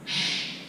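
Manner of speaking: sharp "shh" of a scolding waiter; shushing. Retold or imitated. Waiter at the restaurant to the yelling dad, shushing. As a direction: imitate an offended waiter shushing a guest. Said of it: "Yes, I got shush".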